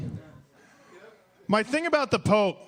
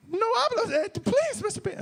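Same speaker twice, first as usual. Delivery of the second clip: put-on pleading kids. Kids begging Mister Beast for food, high child voice. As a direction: beg like a hungry little kid. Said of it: "Kids behind him like".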